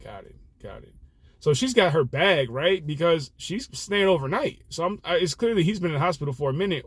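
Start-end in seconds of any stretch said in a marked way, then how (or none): 0.0-0.9 very low, hushed reply; subdued acknowledgement. Retold or imitated. Driver hearing passenger's husband's hospital stay, hushed acknowledgement. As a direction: take the news in softly, under the breath.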